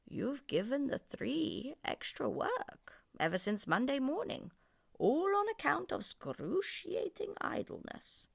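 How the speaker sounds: high, slow mock stern spirit; a hint of a stern magic spirit; desert spirit scolding lazy camel; din scolding.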